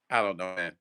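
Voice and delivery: low put-on voice on a livestream; odd low voice. Real, not acted. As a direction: drop into a strange low register without knowing why. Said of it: "I don't even know why I'm talking like this".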